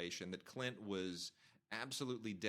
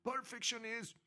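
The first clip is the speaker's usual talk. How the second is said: high mocking shriek from the rafters; mock scream; 12 semitones higher, slower than usual. Woman in the rafters cursing at Tarantino, high mock scream. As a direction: shriek it like an outraged fan. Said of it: "some woman up in the rafters is screaming".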